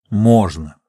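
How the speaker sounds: settled statement with a falling end; firm answer. Acted. Geologist answers her pressing question.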